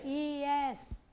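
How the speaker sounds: ईएस stretched very slowly, letter by letter; drawn-out answer. Shown as a demonstration. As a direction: model ईएस slowly for the class. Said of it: "आईएस बदल के क्या हो जाएगा बहुवचन में?".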